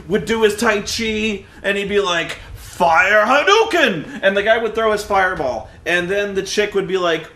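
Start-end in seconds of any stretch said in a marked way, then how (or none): 2.5-4.2 slow, drawn-out, louder cartoon battle cry; hammed-up attack call. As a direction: call out the move like a cheesy anime fighter, laughing through it. Mocking the movie's fight dialogue.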